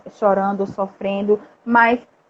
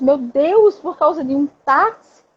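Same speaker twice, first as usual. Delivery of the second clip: shocked disbelief; 8 semitones higher than usual. Retold or imitated. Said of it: "Eu disse".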